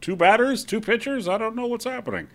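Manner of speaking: high, dopey local guy; clueless Florida fan voice; Saint Petersburg voice. Acted. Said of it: "That's another voice I'm, I'm doing right now. It's a Saint Petersburg, uh, uh, voice".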